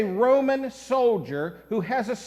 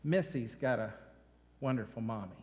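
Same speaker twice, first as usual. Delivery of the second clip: quiet self-encouragement; 11 semitones lower, 10 dB quieter, faster than usual. Retold or imitated. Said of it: "She says".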